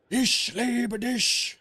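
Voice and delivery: high, drawn-out angry yelp; mock angry German. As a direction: imitate a wife's furious German love declaration, stretched out and high. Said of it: "That language is all about being angry".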